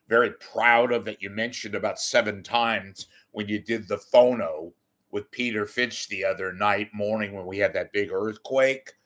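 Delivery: usual speaking voice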